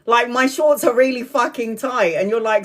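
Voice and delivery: ordinary talk, nothing marked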